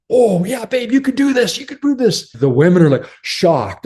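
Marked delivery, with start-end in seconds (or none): 0.1-2.3 high, bright cheering for his wife; husband cheering his wife at the final test; eager encouragement. Retold or imitated. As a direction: pump her up, she's got this. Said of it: "the husbands are like".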